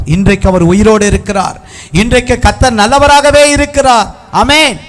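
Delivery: preaching that climbs high and strong; rousing proclamation. Real, not acted. Preacher declaring God's goodness to the congregation, rising fervour. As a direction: build to a ringing Amen.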